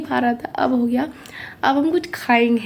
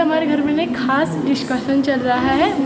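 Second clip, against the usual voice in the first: normal talking voice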